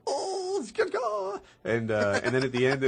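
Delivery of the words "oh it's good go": very high, excited announcer squeal; mock fight announcer. Retelling the site's commentary, very high mock announcer. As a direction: squeal it like a hyped ring announcer. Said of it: "And they're like".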